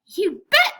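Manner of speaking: a high, loud, thrilled squeal. Acted. Lily answers her first customer's call, squealing with joy. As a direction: blurt it out like a delighted kid. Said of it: "I squealed".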